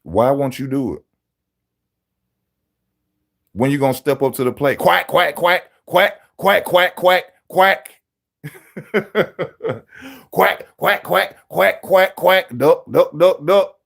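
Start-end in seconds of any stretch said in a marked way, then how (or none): 4.8-11.0 high mock duck quacks; duck quacking. Mocking Tank as a duck. 11.0-13.8 high mock duck quacks; duck quacking. Continues duck taunt.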